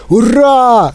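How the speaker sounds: high, drawn-out cheer; storybook hurrah, pitched well up; excited cry. A narrator's reading. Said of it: "закричав дідунь Джо".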